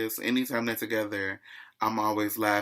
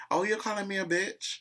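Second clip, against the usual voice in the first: high-pitched country retort; mock country voice, high; 9 semitones higher than usual. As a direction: imitate an indignant country woman, pitched up. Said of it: "In her country voice".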